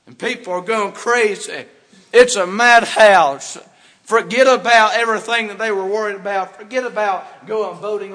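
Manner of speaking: fiery preacher roar; preaching at full force. Real, not acted. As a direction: hammer it home to the congregation. Preacher peaks, madhouse.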